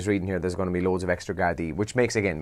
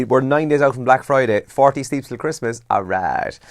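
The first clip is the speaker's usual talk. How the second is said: ordinary talk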